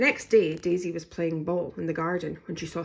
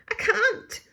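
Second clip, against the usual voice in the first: sobbing; 8 semitones higher, 6 dB louder than usual. A narrator's reading. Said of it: "sobbed Daisy".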